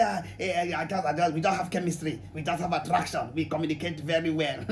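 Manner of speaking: low and soft, mocking a naive lover's excuse. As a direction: mumble it like a smitten person making excuses.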